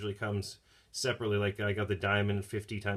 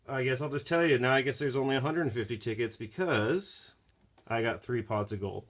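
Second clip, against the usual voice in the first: ordinary delivery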